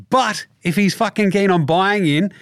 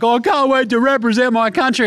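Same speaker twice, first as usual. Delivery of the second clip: high, hammed-up eager voice; mock gushing Simmons; 8 semitones higher than usual. Host mimics Simmons gushing about Australia, high mock eagerness. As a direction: oversell the patriotism.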